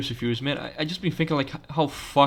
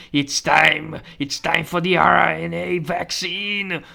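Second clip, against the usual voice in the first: loud, higher, drawn-out villain voice; villain's proclamation; 5 semitones higher, 4 dB louder, much slower than usual. Acted. Doctor announcing it's time for the vaccine, triumphant villain. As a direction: proclaim it grandly, stretching the words.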